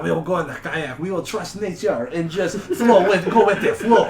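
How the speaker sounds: a carefree Frenchman trusting nature, laughing; dreamy French guy. Retold or imitated. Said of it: "And they're like".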